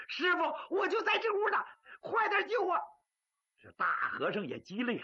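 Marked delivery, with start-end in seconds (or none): 0.1-2.8 urgent high shout. Acted. Chained boy shouts to the master outside, high and urgent. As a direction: call out fast, he's right here.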